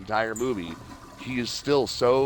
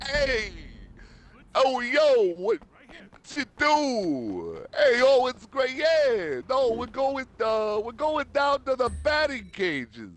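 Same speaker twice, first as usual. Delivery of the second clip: very high, slow, drawn-out New York chatter; lazy stretched-out phone greeting; casual New York hello; slower than usual. Retold or imitated. Guy on the phone telling friends about batting cages, New York voice. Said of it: "casually saying hello".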